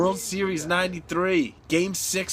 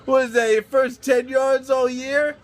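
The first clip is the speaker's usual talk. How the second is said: mocking heckler; 7 semitones higher, 5 dB louder, slower than usual. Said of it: "some fan goes".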